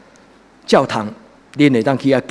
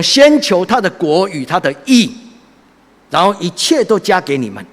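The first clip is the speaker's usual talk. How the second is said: proclaiming scripture; 6 semitones higher, 5 dB louder than usual. Acted. Quoting scripture loudly.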